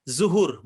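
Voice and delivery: zuhur with a plain z, the wrong way; the mistaken zuhur. Shown as a demonstration.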